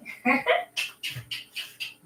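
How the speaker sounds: a high, airy giggle; high breathy giggle. Real, not acted. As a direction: giggle at your own dream. Laughs at her own idea.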